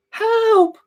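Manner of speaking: put-on very high girlish cry of help; girl's cry for help. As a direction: squeak out help like a small trapped girl. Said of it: "a girl's voice go".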